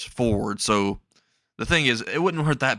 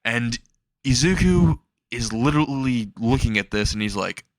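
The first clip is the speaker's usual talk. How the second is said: usual speaking voice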